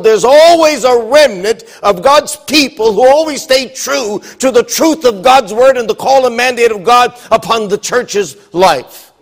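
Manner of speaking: preaching. Real, not acted. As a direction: stir up the church with the message. Preaching to the congregation.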